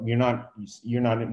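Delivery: ordinary talk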